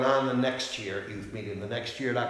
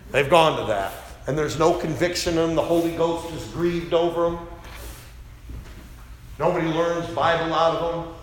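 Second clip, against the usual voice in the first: loud, higher preaching against other Bibles; fiery preaching; 5 semitones higher, 4 dB louder than usual. Acted. Pastor denouncing modern Bibles to the congregation, fiery and loud. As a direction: thunder it from the pulpit.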